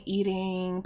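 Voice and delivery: usual speaking voice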